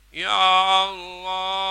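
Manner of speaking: high, loud, slow outcry; loud cry. Shown as a demonstration. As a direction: cry it at full force. (seruan sekeras-kerasnya)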